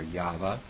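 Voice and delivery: Yahweh said YAH-vuh; variant pronunciation. Shown as a demonstration. Teacher listing possible pronunciations, Yahweh as YAH-vuh. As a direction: say Yahweh with a v and "uh" ending. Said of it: "however it's supposed to pronounce".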